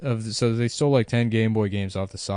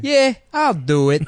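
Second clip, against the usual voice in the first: higher, louder put-on tough guy; put-on shady hired man; 8 semitones higher, 7 dB louder than usual. Exterminator agreeing to take the job, put-on shady voice. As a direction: play an eager shady guy taking the job.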